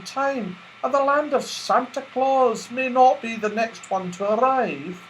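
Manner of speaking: sweet and wondering; Silky, gentle. Acted. Silky talking about Santa Claus, high and sweet.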